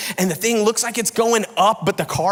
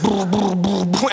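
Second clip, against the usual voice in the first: imitated machine whirr, brr brr; jack grinding noise; 2 semitones higher than usual. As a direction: buzz the lips like a stuck jack. Imitating jack sinking in mud.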